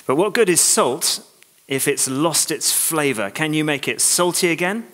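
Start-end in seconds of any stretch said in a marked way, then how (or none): none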